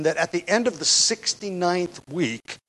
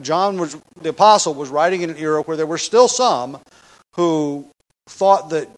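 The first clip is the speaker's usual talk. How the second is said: usual speaking voice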